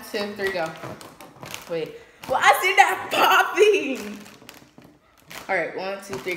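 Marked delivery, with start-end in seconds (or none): 2.3-4.2 high, loud, drawn-out complaint; exasperated yell. Real, not acted. Vlogger fighting a balloon that won't pop, exasperated, high and loud. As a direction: wail it in real frustration.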